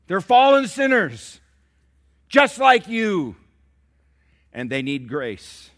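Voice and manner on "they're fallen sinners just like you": much higher, louder, slower: fallen punched hard; loud repeat. Shown as a demonstration. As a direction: hammer home "fallen" as a cry. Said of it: "Did you hear what I said?".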